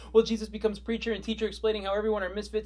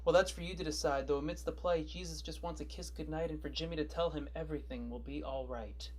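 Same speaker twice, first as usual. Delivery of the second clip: subdued comedown; 2 semitones lower, 7 dB quieter than usual. Acted. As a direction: drop to a hushed tone after the hype. Comedown after the manic character introduction.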